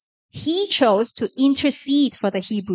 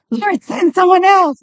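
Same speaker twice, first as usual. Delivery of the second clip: pleading Moses; 6 semitones higher, 5 dB louder than usual. Acted.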